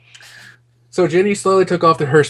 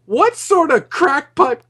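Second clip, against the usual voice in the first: higher giggling disbelief; laughing; slower than usual. Real, not acted. Reading an absurd fanfic line aloud, laughing in disbelief. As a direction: laugh through the words in disbelief.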